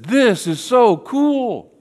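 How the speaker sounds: high, slow, gushing excitement; Peter. Acted. Peter blurting at the Transfiguration, comically thrilled. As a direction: blurt it out in stretched-out awe. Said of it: "starts to speak without thinking".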